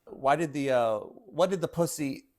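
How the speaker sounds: ordinary talk, nothing marked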